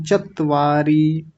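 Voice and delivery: चत्वारी as chatt-vaari, held t; doubled t. Shown as a demonstration. Teacher imitating common error, चत्वारी with two t's. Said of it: "दो त लगा करके ऐसे बोलते हैं".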